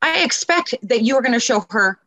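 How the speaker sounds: high-pitched, pointed "excuse me" reprimand; acted-out polite telling-off; stepping in to scold. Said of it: "I go up to them all the time".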